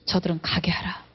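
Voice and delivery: whisper. Acted. Jesus releasing his disciples, whispered gently. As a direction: let the words fall as a hushed plea.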